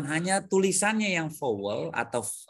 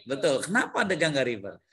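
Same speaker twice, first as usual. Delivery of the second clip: usual speaking voice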